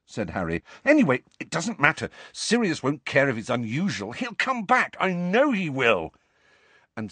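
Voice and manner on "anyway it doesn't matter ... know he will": fast, rising protest; stubborn hope, pitched high; insistent. A narrator's reading. Harry insists Sirius will return, stubbornly hopeful.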